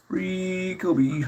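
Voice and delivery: high, drawn-out sing-out; playful name call. Real, not acted. Streamer hailing a viewer in chat, playful high drawn-out call. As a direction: stretch the name out high and long.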